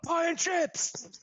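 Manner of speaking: slow high shout; shouted order. Pretend. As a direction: bellow the order. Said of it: "imagine going, going into a restaurant".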